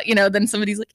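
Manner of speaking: ordinary talk, nothing marked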